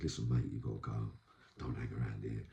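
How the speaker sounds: low, soft, urgent warning; quiet warning. Retold or imitated. Warning a mate in prison to get out, hushed. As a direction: tip him off softly so no one hears.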